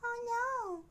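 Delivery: ordinary talk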